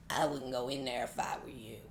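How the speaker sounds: deep gravelly radio DJ growl; Wolfman Jack voice. Retold or imitated. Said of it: "let me see if I can do it".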